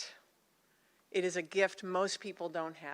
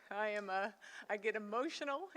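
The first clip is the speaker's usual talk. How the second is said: ordinary talk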